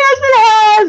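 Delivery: high, loud wailed protest; re-enacted hysterical retort; mock meltdown. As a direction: wail back, refusing to be comforted. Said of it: "I'm doing the whole thing".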